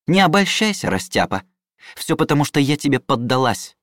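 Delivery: sharp, high angry retort; angry snap. Acted. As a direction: snap at him, furious. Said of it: "мигом взбеленившись".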